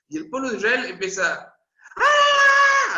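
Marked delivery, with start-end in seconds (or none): 2.0-3.0 huge, high, loud battle shout; a soldiers' war whoop from the storyteller; Israelites' battle cry. Retold or imitated. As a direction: let out a full war cry. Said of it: "a gritar, porque dice que gritaron".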